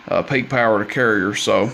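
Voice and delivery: usual speaking voice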